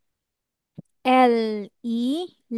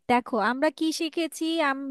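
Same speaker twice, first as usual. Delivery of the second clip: ordinary delivery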